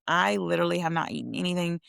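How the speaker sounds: usual speaking voice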